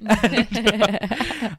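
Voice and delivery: high-pitched delighted laugh; high giggle. Real, not acted. Host laughing at her own Carrie comparison, high giggle.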